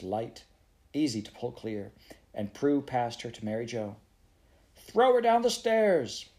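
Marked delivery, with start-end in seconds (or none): 4.8-6.3 high, loud cries; urgent shouting. Acted. Two girls shouting in a burning house, urgent. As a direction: shout it, then echo it rising in disbelief.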